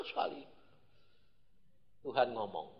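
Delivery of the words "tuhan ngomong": normal talking voice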